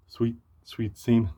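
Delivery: soft, breathy mock whisper of the lyric; imitated whisper. Reviewer mimicking the rapper's whispered line, softly hushed. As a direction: hush it like someone might overhear. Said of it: "why'd you whisper it?".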